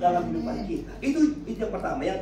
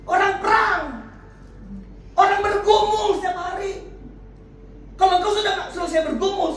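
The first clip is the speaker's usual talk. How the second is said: raised, insistent sermon voice swelling to shouts; impassioned preaching; 7 semitones higher, 4 dB louder than usual. Real, not acted. Preacher urging congregation to bring struggles to God, impassioned preaching. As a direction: drive it home to the congregation, phrase by phrase.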